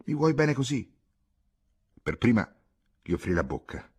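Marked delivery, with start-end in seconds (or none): 0.1-0.9 higher, lightly raised cry; mildly shouted taunt. A narrator's reading. Ghisola crying out to offend Pietro, mildly shouted. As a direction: throw it at the listener to offend, half-shouted. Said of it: "gridò per offenderlo".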